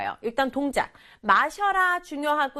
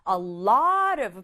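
slow model phrase; 2 semitones lower, 3 dB louder, much slower than usual. Shown as a demonstration. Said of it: "뭐를요".

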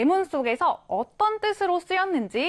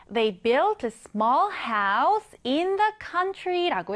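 slow example reading; 2 semitones higher, much slower than usual. Shown as a demonstration. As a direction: read the sentence very slowly. Reads example sentence slowly. (천천히 또박또박 예문 읽기)

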